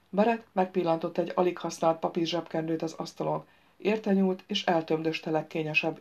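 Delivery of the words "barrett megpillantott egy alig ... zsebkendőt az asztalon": plain reading voice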